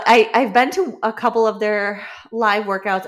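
normal talking voice

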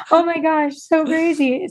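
high, amazed exclamation; recalling her own amazement at the surprise, high-pitched. Retold or imitated. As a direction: gush it up high in disbelief.

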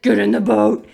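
loud, deep, gravelly bark of a command; deep grovelly voice. Retold or imitated. Husband yelling at his wife in the water, deep gravelly shout. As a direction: boom it out like a gruff husband. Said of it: "this deep, grovelly voice".

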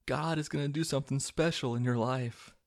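airy high-pitched impression of a devout wife; imitating movie wife. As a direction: play the earnest wife in a mocking falsetto. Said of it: "and she's like".

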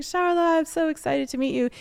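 put-on Francine's French accent. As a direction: greet her with an excited French accent. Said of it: "she's got this really beautiful French accent".

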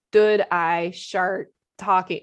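very slow, mushy imitation of "Should I start talking"; slurred words. Wife mimics groggy husband on video, slow and garbled. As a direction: slur "start" toward "shart", dragging each word out. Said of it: "it was all messed up".

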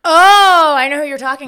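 big, high, loud "oh" of recognition; loud realization. Real, not acted. Co-host realizes who the mystery girl is, loud recognition. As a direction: burst out as it suddenly clicks.

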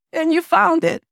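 high-pitched, choked-up retelling; imitated tearful woman. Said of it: "she's crying the entire time".

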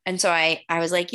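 ordinary delivery

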